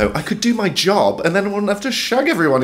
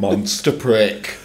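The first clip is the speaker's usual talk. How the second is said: relishing; 8 semitones lower, much slower than usual. Real, not acted. Savouring the phrase slowly.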